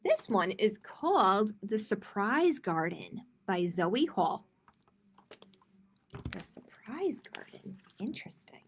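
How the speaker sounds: put-on gentle storybook read-aloud for kids; reading to children. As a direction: read it like storytime for little ones. Reading a picture book aloud.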